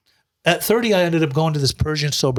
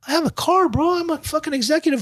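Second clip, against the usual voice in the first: much higher, indignant Persian-American bravado; indignant protest; 13 semitones higher than usual. Retold or imitated. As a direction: push back on the label with a much higher voice. Retorting to friend calling him homeless.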